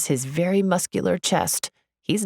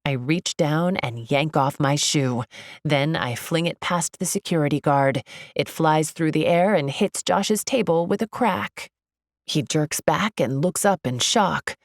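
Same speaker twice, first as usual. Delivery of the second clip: ordinary delivery, nothing marked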